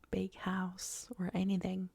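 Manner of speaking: normal talking voice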